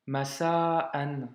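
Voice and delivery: "masa'an" broken before "an"; careful demo of "masa'an" with a stop; hamza blocked. Shown as a demonstration. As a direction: hold "masa", catch it, then release "an". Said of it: "Tu vois, blocage".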